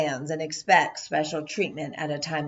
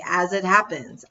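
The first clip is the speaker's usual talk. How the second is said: ordinary delivery, nothing marked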